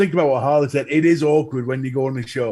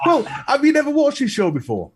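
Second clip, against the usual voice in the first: high-pitched disbelieving question; mock incredulous; 10 semitones higher than usual. Host to an unprepared guest, mock incredulous. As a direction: imitate an astonished host, pitched up.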